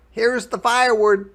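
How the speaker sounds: put-on high, loud, fast boy's call; excited Jack shout. A narrator's reading. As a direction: shout it like an eager kid. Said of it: "shouted Jack".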